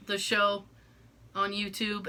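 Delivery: ordinary delivery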